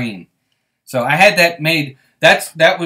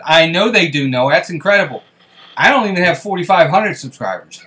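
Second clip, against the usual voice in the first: normal talking voice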